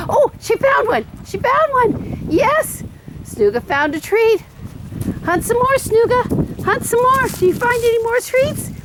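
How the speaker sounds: very high, sing-song cheering for the dog; excited dog talk. Real, not acted. Owner urging her dog to hunt Easter treats, very high excited voice. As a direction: egg the dog on in a high, happy voice.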